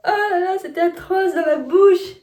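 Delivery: high, loud cry of disgust. Real, not acted. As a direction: groan it out, revolted and loud. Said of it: "j'ai détesté".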